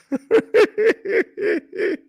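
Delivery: high burst of real laughter; big laugh. Real, not acted. Streamer bursts out laughing at a viewer's comment. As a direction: crack up at the chat.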